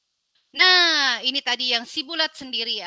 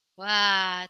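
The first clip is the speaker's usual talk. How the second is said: ordinary delivery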